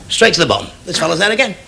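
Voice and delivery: ordinary talk, nothing marked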